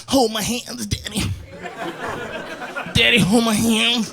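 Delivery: put-on high, whimpering child plea; whiny pleading kid. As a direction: beg daddy not to leave. Son pleading on toilet.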